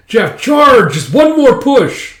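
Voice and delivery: loud, high rallying shout; shouted order to push on; battle cry. Acted. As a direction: yell the charge across a battlefield.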